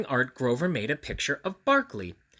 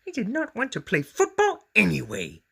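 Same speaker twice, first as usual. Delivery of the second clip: higher, louder pouting monster voice; sulky Grover; 9 semitones higher, 4 dB louder than usual. A narrator's reading. Grover rejected by the monsters, pouting. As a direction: sulk it out as Grover.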